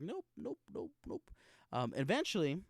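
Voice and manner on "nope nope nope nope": high squeaky nope-nope; high, soft playful refusal; mock denial. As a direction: squeak out the denials like a cornered girl. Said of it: "Literally just like".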